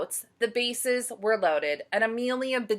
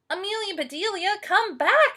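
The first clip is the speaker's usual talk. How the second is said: high loud calling; shouting boys; 12 semitones higher, 5 dB louder than usual. Acted. Boys calling after the runner, loud shout. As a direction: call her back as she runs off. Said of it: "shouted the boys".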